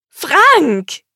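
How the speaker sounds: high, loud cry of his name; shriek. A narrator's reading. Woman unrolls her surprise gift, shrieking at boyfriend. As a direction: squeal it out in delight. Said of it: "und kreischte auf".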